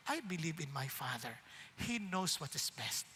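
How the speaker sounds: soft, low, trusting voice; calm trust. Pretend. Jesus quietly trusting his Father, soft and calm. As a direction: say it gently, at peace.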